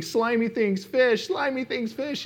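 high-pitched mock panicked chant; imitated freaking out. Husband mimicking his wife in the lake, high panicked babble. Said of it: "And she's freaking out".

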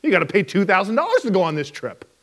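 normal talking voice

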